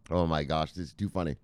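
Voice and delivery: usual speaking voice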